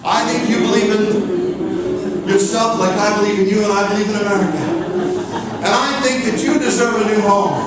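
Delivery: slow, loud, building grand speech; stump-speech to a customer over humming; patriotic pitch. Pretend. Realtor pitching a customer while the room hums, grand patriotic speech.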